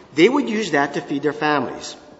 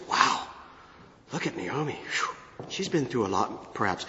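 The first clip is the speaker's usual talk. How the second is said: hushed gossip; 6 semitones lower, 11 dB quieter than usual. Retold or imitated. Townspeople gossip about Naomi.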